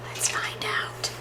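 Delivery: whispered, secretive question. Acted. Storyteller whispers to children before the reveal. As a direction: lean in and whisper the mystery. Teasing question to young listeners.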